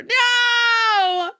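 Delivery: long, high, loud wail of no; dismayed wail. Acted. Streamer reacting to chat failing at rolled Rs, wailed protest. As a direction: cry out in drawn-out mock despair. Mock dismay at chat's message.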